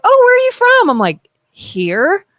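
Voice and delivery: ordinary talk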